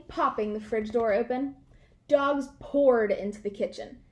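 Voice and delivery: ordinary delivery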